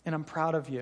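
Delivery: soft, tender and sincere; tender God's voice. Retold or imitated. Jesus telling his child, soft and tender. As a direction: say it gently as a loving father. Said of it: "He says".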